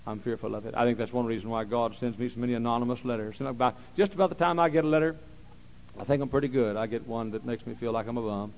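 ordinary talk

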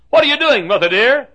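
high, loud calling question; child's cry. Acted. Child in the poem calling to mother, high loud cry. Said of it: "my straying voice would cry".

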